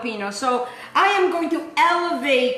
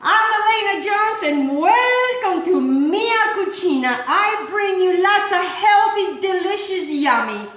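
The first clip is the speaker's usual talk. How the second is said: upbeat presenter voice, higher; show host intro; 6 semitones higher, 4 dB louder than usual. Real, not acted. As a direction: welcome viewers like a TV cook.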